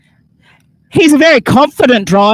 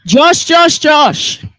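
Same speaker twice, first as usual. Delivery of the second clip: calling out; 2 semitones higher, much slower than usual. Real, not acted. Calling someone across a crowd.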